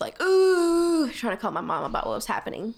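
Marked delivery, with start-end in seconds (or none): 0.2-1.1 high, loud drawn-out "ooh"; imitated wail of pain, held long; mock moan. Hurt at the side of the church, mock moaning.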